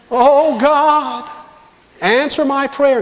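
high, loud, drawn-out mock plea; hammed-up pleading prayer. Preacher acting someone begging God for an answer, high plea. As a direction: cry out to heaven theatrically.

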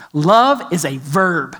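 slow, weighty "Love is a verb." with love leaned on hard; stress on love. Real, not acted. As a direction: spell out "Love is a verb." slowly, each word landing. Preacher stressing key phrase.

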